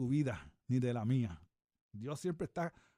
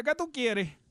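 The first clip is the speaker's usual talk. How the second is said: a touch of a loud, higher reply; imitated answering call; 7 semitones higher, 6 dB louder, slower than usual. Other sister playing God answers her, lightly imitated loud reply.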